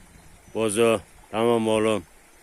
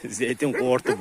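laughing; 3 semitones higher, slower than usual. Real, not acted. Laughing at the scared dog.

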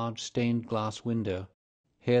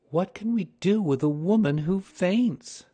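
exasperated wife; 10 semitones higher, 7 dB louder than usual. A narrator's reading. Wife complains about fainting housekeeper.

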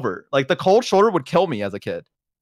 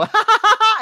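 high, loud mocking "ha, ha, ha"; taunting kid laugh; 5 dB louder than usual. Kids taunting the babysitter, mocking laugh. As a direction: tease like a cheeky child. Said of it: "they would go".

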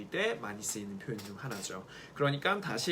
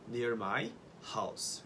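Near my house drawn out very slowly; slow model phrase; 2 semitones lower, 4 dB quieter, much slower than usual. Shown as a demonstration. Teacher dictates next phrase to learners, slowly. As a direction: say it slowly so students can repeat. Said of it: "다음 뭐라고 얘기하면 좋을까요?".

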